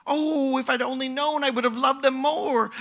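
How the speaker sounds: high-pitched, pleading regret; earnest regret. Acted.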